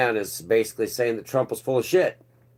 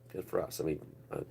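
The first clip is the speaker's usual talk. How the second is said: much softer, lower repeat; muttered aside; 7 semitones lower, 17 dB quieter, faster than usual. Shown as a demonstration. Repeating his jab to the viewers under his breath. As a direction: drop it to a dry mutter.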